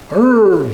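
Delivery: loud, high dog growl running into a motorcycle rev; growl plus engine rev. Retold or imitated. Colleague putting both noises together, loud imitated growl-rev. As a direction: growl and rev it as one name. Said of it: "Put that together".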